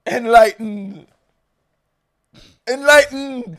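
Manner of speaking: loud, slow "Enlighten" ending on N; insistent demonstration. Shown as a demonstration. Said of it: "What's this word?".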